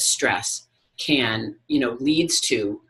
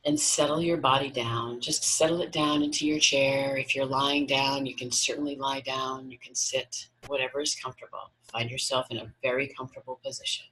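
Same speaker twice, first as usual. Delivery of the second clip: guided meditation. Acted. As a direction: guide them gently into relaxation, unhurried.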